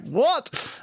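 a high, disbelieving what; shocked what. Retold or imitated. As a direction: sound stunned at the plan. Shocked at jumping into the hole.

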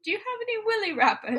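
high, quick, dainty-sounding request; mock shop customer. Pretend customer asking at the candy shop, high-voiced.

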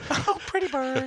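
high parrot imitation. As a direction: chirp it up high like a bird. Echoing parrot line.

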